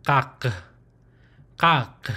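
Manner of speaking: "kak" closed with a hard final k from the throat; closed syllable kak. Shown as a demonstration. Teacher demonstrating the closed syllable "kak". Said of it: "memorize how it sounds".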